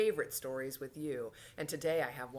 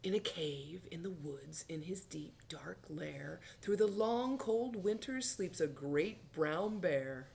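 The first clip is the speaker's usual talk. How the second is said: put-on expressive, drawn-out storytelling for children; story reading; 3 semitones higher, slower than usual. A narrator's reading. Teacher reads bear book to children, storybook narration. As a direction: linger on the rhymes, swelling with the action.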